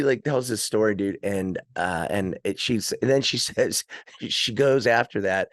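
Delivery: ordinary delivery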